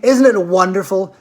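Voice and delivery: usual speaking voice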